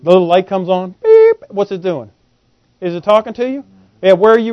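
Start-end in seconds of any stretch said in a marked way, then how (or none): 1.0-1.4 high, loud electronic beep imitated. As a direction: chirp it like a dashboard warning light. Low fuel light signals.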